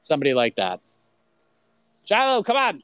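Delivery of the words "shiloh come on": high sing-song call to the dog; talking to a dog. Real, not acted. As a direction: coax the dog back in. Calling his dog to come back.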